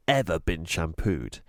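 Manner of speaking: ordinary delivery, nothing marked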